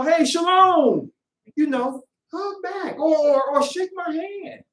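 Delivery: high, slow, sing-song hello; lightly hammed-up friendly greeting; cheery greeting. Acted warm greeting.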